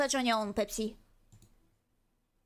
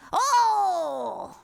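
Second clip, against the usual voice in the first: sudden loud oh, much louder; loud exclamation; 4 semitones higher, 8 dB louder than usual. Real, not acted. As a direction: blurt it out in outrage.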